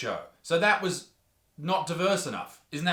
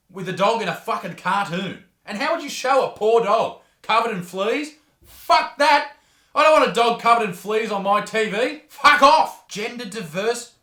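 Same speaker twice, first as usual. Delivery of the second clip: shouted rant, much higher and louder; comic fury; 5 semitones higher, 8 dB louder than usual. Acted. Host yelling at the Bluey critic, furious shout. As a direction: explode at the critics.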